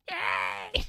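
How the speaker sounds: strained little cartoon cheer; put-on mascot squeal; Toad. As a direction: cheer in a silly squawk. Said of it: "force him to do the Toad impression".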